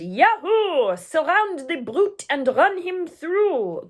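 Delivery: high, loud, slow war cry; screaming mob. Acted. The French mob screams as they charge, high and loud. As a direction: charge with a whoop. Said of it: "They screamed".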